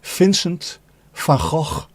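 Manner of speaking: "Vincent van Gogh" said slowly as "Fincent fan Khokh", F for V and throaty ch for G; Dutch pronunciation. Shown as a demonstration. Streamer teaching chat the Dutch way, slow and deliberate. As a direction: spell out "Vincent van Gogh" with an F sound and a guttural ch. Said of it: "is pronounced".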